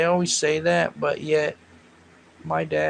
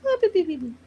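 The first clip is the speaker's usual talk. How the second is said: snore imitation; 14 semitones higher, much faster than usual. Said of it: "you'd be like".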